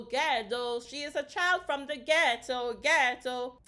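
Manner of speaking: rhythmic, drawn-out lyric reading; reciting lyrics. Acted. Reading the singer's lyrics aloud, rhythmic recital. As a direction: chant the repeats to a beat.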